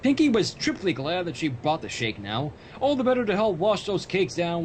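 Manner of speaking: ordinary talk, nothing marked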